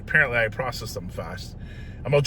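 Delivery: ordinary delivery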